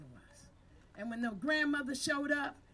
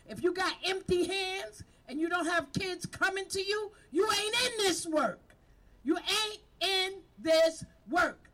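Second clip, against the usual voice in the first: shouting higher and louder, voice cracking, slowing down; fired-up shout; 6 semitones higher, 7 dB louder than usual. Real, not acted. Leader scolding idle team members, fired-up shout. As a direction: drive it home with a full, breaking yell. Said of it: "I don't like to get all fired up like this".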